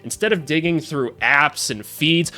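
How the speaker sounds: upbeat sponsor pitch; energetic ad read, rising at the end. Acted.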